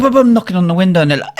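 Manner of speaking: ordinary delivery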